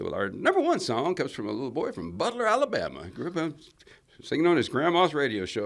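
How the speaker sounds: radio DJ announcing. Retold or imitated. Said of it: "And he said".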